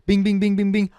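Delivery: loud, high bing bing gunshot noises; imitated gunshots. Retelling the shooting to viewers, loud mock gunshot noises. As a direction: fire off each bing like a shot.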